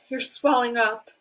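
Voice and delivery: ordinary delivery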